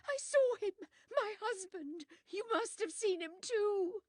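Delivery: high, loud pleading cry; frantic insisting. Acted. Widow begging Poirot to confirm the ghost, frantic high cry. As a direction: insist in panic that he saw it.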